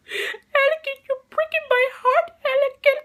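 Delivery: very high-pitched mock-heartbroken voice; hammed-up squeaky female impression, pleading; Padme. Reviewer mocks Padme's terrible dialogue, hammed-up heartbreak. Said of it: "especially coming out of Padme and Natalie Portman".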